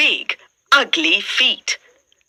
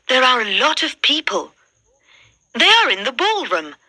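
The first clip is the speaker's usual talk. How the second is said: plain reading voice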